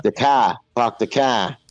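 dropped-R Boston "car"; mock Boston, R left off; Boston accent. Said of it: "that accent".

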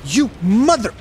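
screaming in furious frustration at the game; real rage. Real, not acted. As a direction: yell it out, truly fed up. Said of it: "I'm so mad!".

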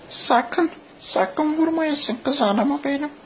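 high pleading falsetto mother; begging mom voice, way up high; turkish cartoon dub.

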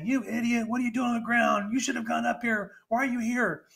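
high, harsh mock scolding; scolding the victim. Mocking how not to help victim.

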